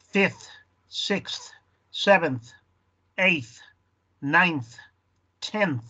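each ordinal alone, "fifth" to "tenth", ending in clear th; careful teacher's list, audible th closing "seventh", "eighth", "ninth"; pronounced final th. Shown as a demonstration. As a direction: blow the th at the end of "fifth", "sixth", "tenth". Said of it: "Hay que pronunciar ese th".

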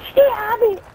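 shrieked stop. Real, not acted. Gamer shrieks at teammate to stop, high and loud. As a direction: scream it to make them stop. Yelling at attacker.

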